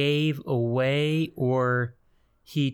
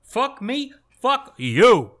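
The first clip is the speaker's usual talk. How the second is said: put-on high, loud New York accent yelling; textbook New York; 7 semitones higher, 5 dB louder, much slower than usual. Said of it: "delivers a textbook New York".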